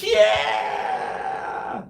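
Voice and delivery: high, long whooping shout; retold excited yell. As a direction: re-enact a pumped-up battle yell. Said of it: "reprimanded for yelling all the time".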